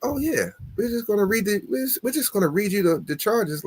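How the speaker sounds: breezy, offhand imitation of the lady; a hint of a bored receptionist reading charges; nonchalant. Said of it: "she said it so nonchalantly".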